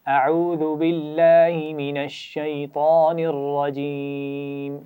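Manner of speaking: very slow drawn-out recitation. Acted. Teacher reciting for children to repeat, very slow and drawn out. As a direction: stretch each word for the class to follow. Leads taawwuz and tasmiya.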